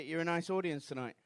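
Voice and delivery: usual speaking voice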